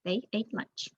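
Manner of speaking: ordinary delivery, nothing marked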